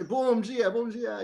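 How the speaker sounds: cheery Portuguese "Bom dia" on arrival in Brazil; Portuguese greeting. Retold or imitated. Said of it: "you immediately start speaking Portuguese".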